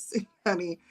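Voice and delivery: usual speaking voice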